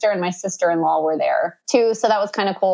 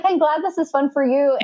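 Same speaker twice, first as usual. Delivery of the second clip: mock-pleased, higher voice; higher-pitched fake cheer; retold sarcasm; 6 semitones higher than usual. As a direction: act out pretending to be glad for them. Sarcastic reply to drinking family.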